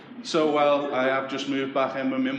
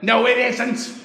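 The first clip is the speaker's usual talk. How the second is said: higher and louder mock mum retort; mum snapping no; 10 semitones higher, 8 dB louder, slower than usual. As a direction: bark it back as a stubborn mum. Mum insisting in quarrel.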